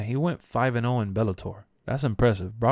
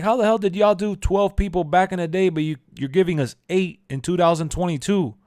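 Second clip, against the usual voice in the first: mock outraged, louder and higher; exasperated rant; 8 semitones higher, 6 dB louder than usual. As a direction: throw the question at them in disbelief. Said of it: "I'm like".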